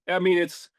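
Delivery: ordinary delivery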